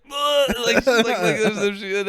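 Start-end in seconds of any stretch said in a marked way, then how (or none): 0.1-0.6 high "ugh" of revulsion; high yelp of disgust; mock disgusted cry. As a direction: imitate a grossed-out friend squealing. Imitating sprayed friend's disgust.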